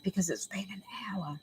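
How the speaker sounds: hushed whispered aside; whispering. Real, not acted. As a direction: whisper so she won't hear. Aside while daughter scolds her.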